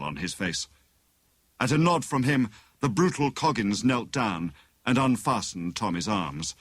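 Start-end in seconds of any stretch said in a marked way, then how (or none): none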